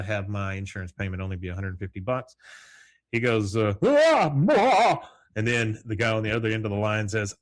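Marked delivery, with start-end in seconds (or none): 3.8-5.1 loud, high-pitched mock scream; acted anguished yell. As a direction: cry out in hammed-up dismay. Hank finds the unsent check.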